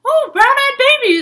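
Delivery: high, loud, gushing mother; sing-song delighted mom; mom's gushing voice. Retold or imitated. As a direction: coo it like a thrilled mom. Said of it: "and she's like".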